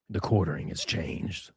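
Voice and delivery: low, slow dramatic trailer voice; gravelly narrator parody. Pretend. Streamer parodying Metal Gear narration, low and slow. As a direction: intone it like a game narrator.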